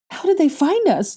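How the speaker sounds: very high, loud panicked question; panicked high cry; frightened cry. A narrator's reading. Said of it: "Ginny cried".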